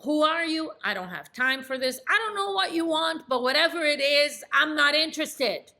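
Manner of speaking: high, loud, irritated brush-off; annoyed. Acted. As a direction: snap at an unwanted caller. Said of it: "Completely annoyed".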